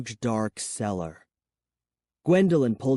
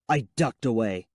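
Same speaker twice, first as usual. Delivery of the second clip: plain reading voice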